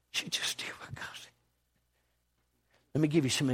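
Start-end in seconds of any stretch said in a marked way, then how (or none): 0.1-1.3 breathy hushed whisper. Acted. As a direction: drop to a bare whisper for effect. Hushed repetition in sermon.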